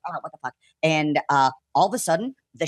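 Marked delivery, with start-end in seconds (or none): 0.1-0.5 much softer, under the breath; whisper-quiet worry in the water; hushed unease. Retold or imitated. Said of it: "I'm like".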